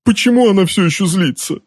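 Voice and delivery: high-pitched desperate question; despairing wail. A narrator's reading. Uncle keeps exclaiming in despair.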